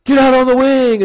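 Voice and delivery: loud, high shouted order; imitated yelling. Instructor yelling in skydiver's ear, loud imitated shout. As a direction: yell it over the engine noise. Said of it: "he's yelling in my ear".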